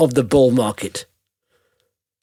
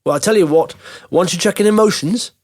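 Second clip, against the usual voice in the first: ordinary talk, nothing marked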